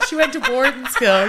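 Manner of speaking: normal talking voice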